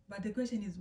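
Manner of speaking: ordinary talk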